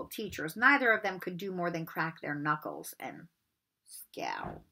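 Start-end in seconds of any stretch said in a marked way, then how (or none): none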